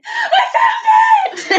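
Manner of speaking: high, slow triumphant shout with a laugh; shouting I found it, laughing; excited shout. Real, not acted. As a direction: yell it out in pure triumph. Shouts on finding the treasure.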